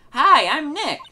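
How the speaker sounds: high, loud hello into a mic; chirpy character greeting. Acted. [name] tries out the camera's mic, high and loud. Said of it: "Maybe I'm not saying it loud enough".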